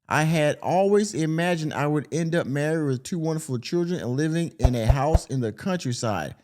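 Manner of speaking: normal talking voice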